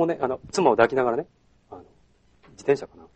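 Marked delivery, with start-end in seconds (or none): 2.5-3.1 deep, soft low voice. Retold or imitated. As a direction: drop the voice down low. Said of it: "低い声で". (低く落とした声)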